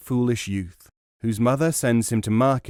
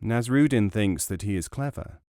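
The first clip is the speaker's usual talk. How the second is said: ordinary delivery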